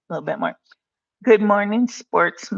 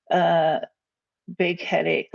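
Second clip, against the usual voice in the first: ordinary talk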